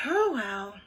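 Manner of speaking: resigned sigh into the words. A narrator's reading. Ivy gives up explaining to her friends, sighing. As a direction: let it out on a sigh. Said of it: "stopped pointing and sighed".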